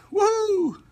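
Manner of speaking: un grito de alegría muy agudo; ¡suéltalo como celebración!; cheering. Real, not acted. Cheering on the livestream.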